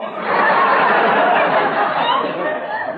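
squeaky nervous giggle that bursts out; high nervous giggle. Acted. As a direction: giggle helplessly from nerves. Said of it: "there I go again".

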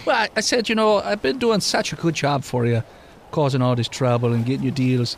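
put-on mildly Irish-tinged lilt for an old immortal NPC; Wisconsin Irish accent. GM voicing the immortal Tom to the players, light Irish brogue. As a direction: keep a light Irish brogue, wry and storytelling. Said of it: "He sounded like he was a Wisconsin Irishman".

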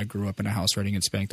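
ordinary talk, nothing marked

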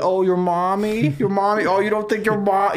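mocking taunt. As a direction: taunt him like a schoolyard bully. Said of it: "they're picking on him".

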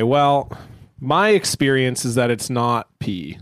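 ordinary talk

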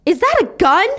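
high, loud startled question; alarmed. Retold or imitated. Onlookers seeing Karen's shotgun, loud startled question. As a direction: exclaim it in acted alarm.

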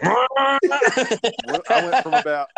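high, slow words through real laughter; laughing. Real, not acted. Laughing mid-crash story.

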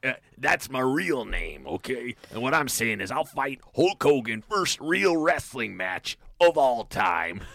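lightly voiced wrestling promo; a touch of Macho Man bravado; Randy Savage impression. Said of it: "When he's like".

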